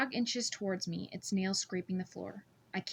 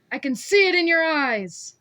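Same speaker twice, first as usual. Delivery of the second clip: much higher, much louder raging shout; furious yell; 8 semitones higher, 14 dB louder than usual. A narrator's reading. Scarred man yells he sees her lie, furious. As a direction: scream it in her face.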